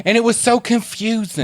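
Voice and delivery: high-pitched comic character; put-on character voice. Character explaining a movie rating, put-on voice. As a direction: pipe up in a silly high voice. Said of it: "you can't do this voice".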